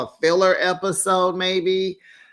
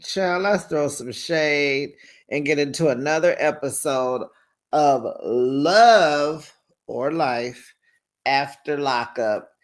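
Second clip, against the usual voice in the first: ordinary talk, nothing marked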